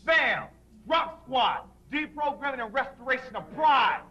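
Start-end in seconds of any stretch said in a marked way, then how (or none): none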